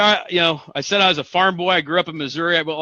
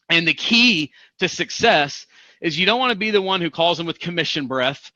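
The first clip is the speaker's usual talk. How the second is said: usual speaking voice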